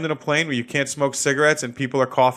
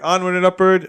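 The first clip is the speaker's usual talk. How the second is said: higher, louder sing-song motto; upbeat catchphrase; 6 semitones higher, 5 dB louder than usual. Real, not acted. Moving on to the news segment, a cheery announced motto. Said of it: "as they say".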